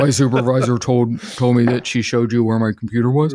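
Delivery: a hint of a creepy killer's voice; Buffalo Bill voice. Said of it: "I make him sound like Buffalo Bill".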